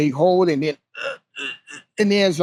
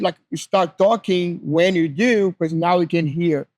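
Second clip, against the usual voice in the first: ordinary delivery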